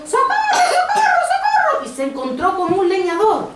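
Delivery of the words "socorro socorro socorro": very high storybook shriek; piercing, acted cry of alarm; shrieking for help. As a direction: shriek for help at the top of your range. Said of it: "se dirigió al bosque chillando".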